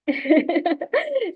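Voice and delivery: bright burst of laughter; short joyful laugh; happy laugh. Real, not acted. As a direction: laugh with relief at finding it. Laughs after finding the book.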